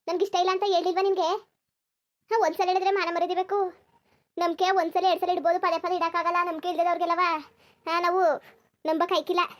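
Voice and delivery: furious outburst. Acted. Kaveri on the phone shouting at her lover to leave her alone, furious. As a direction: yell at him to leave you alone for good. Breakup call, angry outburst.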